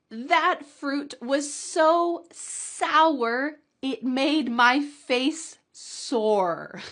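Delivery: very slow, deliberate read; very slow model sentence. Shown as a demonstration. Teacher reading the sour fruit sentence very slowly. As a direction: drag every word out slowly. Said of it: "in a challenge sentence".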